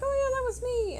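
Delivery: singled-out high mock voice owning up; imitated breezy confession. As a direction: pitch it way up as the oblivious sender. Voicing the sender's casual confession.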